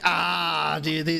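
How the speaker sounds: usual speaking voice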